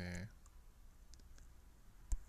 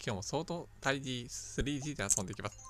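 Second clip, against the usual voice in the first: louder, upbeat greeting to viewers; cheerful channel intro; 3 semitones higher, 7 dB louder than usual. Acted. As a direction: open the video with a bright hello. (元気なオープニングの挨拶)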